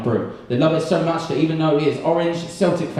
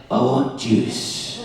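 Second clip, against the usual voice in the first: put-on Glaswegian girlfriend; 2 semitones lower, much slower than usual. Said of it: "had a Glaswegian girlfriend".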